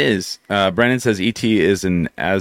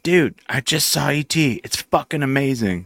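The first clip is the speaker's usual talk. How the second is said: imitating Tom Cruise; 7 semitones higher than usual. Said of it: "he's like".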